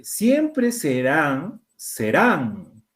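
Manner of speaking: siempre serán stretched very slowly, syllable by syllable; slow reading. Shown as a demonstration. Teacher to class, reading siempre serán very slowly. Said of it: "reading to find tildes".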